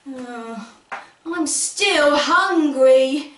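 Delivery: plain reading voice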